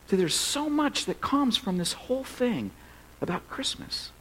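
usual speaking voice

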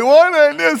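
high mock wail; hammed-up tearful cry; crying runner. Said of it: "people are crying".

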